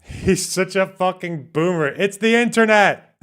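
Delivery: exasperated outburst. Real, not acted. Commentator jeering at a boomer about the internet, exasperated. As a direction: cry out in disbelief, pitch climbing.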